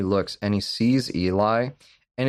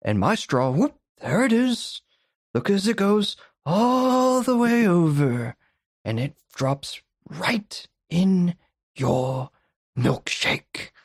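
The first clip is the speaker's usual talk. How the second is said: hammed-up Daniel Plainview; 9 semitones higher than usual. Retelling the milkshake speech to his co-host, hammed-up and gloating. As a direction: savour each word like a gloating villain. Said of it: "He goes".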